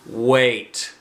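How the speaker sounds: ordinary delivery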